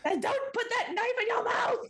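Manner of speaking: high shrill mock shout. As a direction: squeal a playful scolding warning. Joins the mock scolding.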